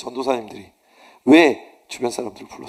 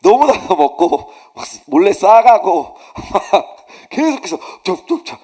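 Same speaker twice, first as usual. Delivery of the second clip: real laughter breaking through the words; laughing while telling; 5 semitones higher, 7 dB louder, slower than usual. Real, not acted. Preacher laughing to the congregation while telling about greedy seminarians, laughing.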